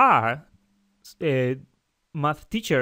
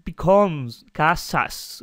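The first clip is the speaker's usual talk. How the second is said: slow demo; much slower than usual. Shown as a demonstration. Teacher to students, slowly showing the added s. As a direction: slowly draw out "casas" to show the added s. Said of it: "So it, it".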